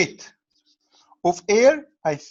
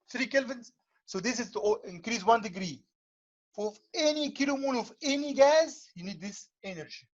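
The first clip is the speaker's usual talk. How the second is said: choppy, dropping connection; radio breaking up; 2 semitones higher, 9 dB quieter than usual. Real, not acted. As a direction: voice cutting out over the call. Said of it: "You're cutting out a lot, Professor".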